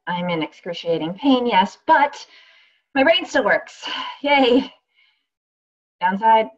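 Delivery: wry, lively first-person performance, full of asides; radio play monologue. Acted. Actress reading a radio play aloud, wry and lively. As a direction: play the injured woman talking straight to the audience.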